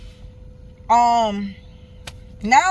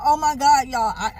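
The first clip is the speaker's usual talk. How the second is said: ordinary talk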